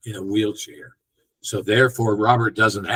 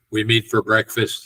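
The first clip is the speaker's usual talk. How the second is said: ordinary talk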